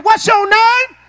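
high and loud, like you mean it; pushed to a high shout after coaxing; shouted, full force. Shown as a demonstration. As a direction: hurl the question at the room. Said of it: "Ain't nothing happening".

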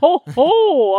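booming jolly ho ho ho; belly-laugh it like Santa. Acted.

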